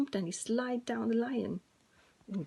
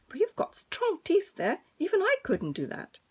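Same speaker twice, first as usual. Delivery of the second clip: impressed lion; 8 semitones higher, 6 dB louder than usual. Acted. Lion praises mouse.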